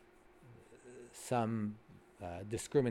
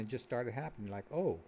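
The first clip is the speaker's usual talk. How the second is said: ordinary delivery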